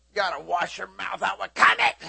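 half-joking telling off; mock scolding. Preacher mock-scolding a cursing woman, loud. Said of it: "mock scolding".

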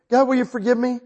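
high, loud re-enacted plea; begging forgiveness. Preacher retells asking God's forgiveness, pleading. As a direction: plead upward, urgently. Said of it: "asking him to forgive me".

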